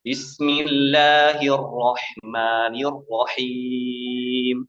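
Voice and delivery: Bismillahirrahmanirrahim drawn out very slowly through the nose; mock the nasal reading slowly; nasal wrong reading. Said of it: "Apa salahnya?". (Bismillahirrahmanirrahim dibaca sangat pelan lewat hidung)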